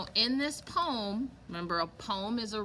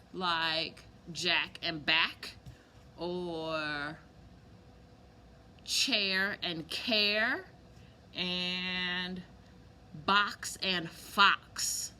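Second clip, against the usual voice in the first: rhyming pairs stretched out slowly; slow rhyme demo; 3 semitones lower, much slower than usual. Shown as a demonstration. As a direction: slowly spell out each pair for children. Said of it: "rhyming means that the words sound similar".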